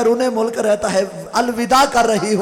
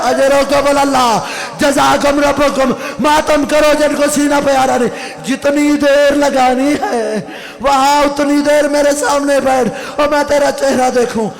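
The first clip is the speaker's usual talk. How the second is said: raised, loud lament of a majlis preacher; mourning recitation; 2 semitones higher, 4 dB louder than usual. Acted. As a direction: cry the story out to the mourners.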